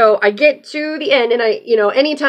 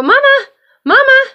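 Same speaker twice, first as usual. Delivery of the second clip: very high, loud sing-song call; calling out to duck; 7 dB louder than usual. Real, not acted. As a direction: call the duck over from across the water.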